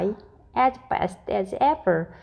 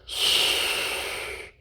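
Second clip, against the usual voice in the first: soft unvoiced "Shh"; shushing; 5 dB quieter than usual. Mister Strong hushing Ginger the cat, soft shush. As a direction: hush the cat softly. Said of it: "said Mister Strong to Ginger".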